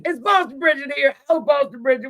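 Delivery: high, fast camp drag-queen voice; a hammed-up high campy host voice; Boston Bridget. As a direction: camp it up, all flair and lilt.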